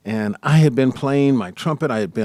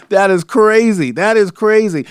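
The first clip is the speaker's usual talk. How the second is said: amazed laugh; 10 semitones higher, 6 dB louder than usual. Real, not acted. Host laughing in amazement at the son's deal. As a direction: laugh then exclaim, higher and louder.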